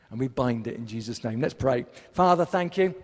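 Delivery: loud, rising prayer voice; booming prayer; preaching prayer. Real, not acted. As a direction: pray out with full force.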